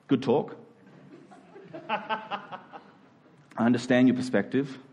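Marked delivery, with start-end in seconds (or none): none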